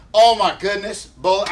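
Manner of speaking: a loud, high burst of delight at the news; excited outburst. Real, not acted. Co-host hears about the tackle, excited loud outburst.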